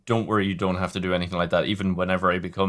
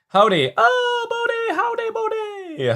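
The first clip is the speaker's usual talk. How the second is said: very high, stretched cartoon hello; high squeaky greeting; slower than usual.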